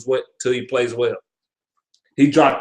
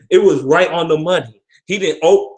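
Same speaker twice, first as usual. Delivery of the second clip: usual speaking voice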